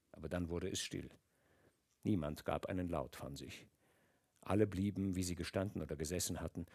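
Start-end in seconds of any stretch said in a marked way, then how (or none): none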